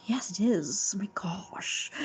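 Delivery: whispered, savoring agreement; hushed fervent agreement. Real, not acted. Agreeing that music is great, hushed fervent whisper. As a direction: drop into an emphatic whisper.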